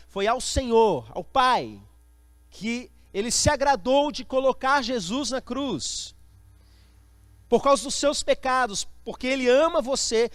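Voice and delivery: loud, emphatic pulpit proclamation; forceful preaching. Real, not acted. Preacher telling the church about the cross, loud and forceful. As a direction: proclaim it with full conviction, hammering the key words.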